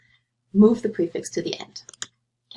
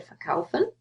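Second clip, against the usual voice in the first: "verkaufen" said with stress on KAU; light VER, weight on KAU in "verkaufen"; stress on the stem; 6 semitones higher, 4 dB quieter than usual. Shown as a demonstration. Teacher giving the answer, KAU stressed in "verkaufen". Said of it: "where's the stress".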